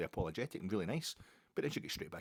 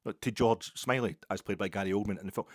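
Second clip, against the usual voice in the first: usual speaking voice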